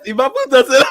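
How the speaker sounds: high, loud parody of mumbled lyric; mocking the lyric. Host parroting a garbled [name] lyric, high and loud. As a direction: repeat the garbled line mockingly. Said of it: "Let me hear that again".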